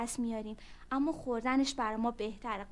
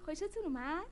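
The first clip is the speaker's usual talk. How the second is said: usual speaking voice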